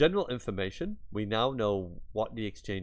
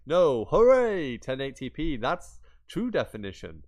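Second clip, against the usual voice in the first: mock hype announcer; 5 semitones higher, 7 dB louder than usual. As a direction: crow it like a fake triumphant advert. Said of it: "And then they were like".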